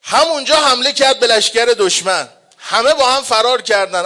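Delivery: normal talking voice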